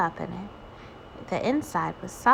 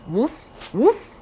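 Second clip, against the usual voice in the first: puppy woofs; 5 semitones higher, 4 dB louder than usual. Acted. Puppy barks.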